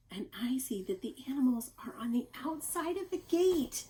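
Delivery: plain reading voice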